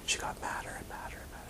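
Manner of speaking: fast, breathy whisper; telling how the student grew angrier, quick whisper; whispered aside. Real, not acted. Whispered aside about her anger.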